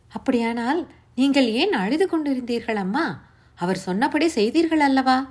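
put-on urgent young listener pressing for the story; young Savitri, anxious. A narrator's reading.

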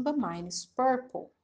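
ordinary talk, nothing marked

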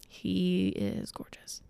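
breathy slow whisper of admiration. Acted. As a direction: whisper it slowly, as if in awe. Repeats praise, then whispers it.

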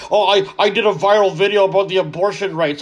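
smug self-congratulating voice, lightly put on; mocking imitation. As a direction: parody a proud viral poster. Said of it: "what they call".

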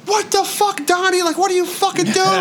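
high, panicked friend voice; alarmed friends. Retold or imitated. Friends in the car panic at his screaming, high alarmed voice. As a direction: mimic the scared friends in the car.

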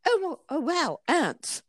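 high-pitched mock British accent. Masseuse redoing her rock star client's line, British accent. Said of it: "sorry, the British accent".

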